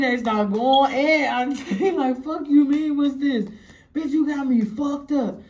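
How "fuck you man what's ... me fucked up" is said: higher-pitched acted cussing-out; retold heated comeback; angry argument. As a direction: go off on them, voice lifted.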